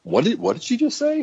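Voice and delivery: high-pitched startled question with a laugh; retold disbelief. Husband hearing the water broke, acted high-pitched shock. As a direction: squeak it out, playing stunned. Husband reacts to labor news.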